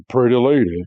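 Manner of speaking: lightly voiced smooth flirt; mock flirtatious come-on; Johnny Bravo. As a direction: slip into a hint of a cartoon ladies' man. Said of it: "When did Brock turn into Johnny Bravo?".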